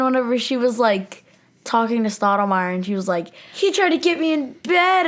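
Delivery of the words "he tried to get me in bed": high-pitched mock outrage; hammed-up dramatic accusation; melodramatic. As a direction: play it as an overblown tattletale. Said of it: "being all dramatic and stuff".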